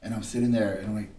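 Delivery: usual speaking voice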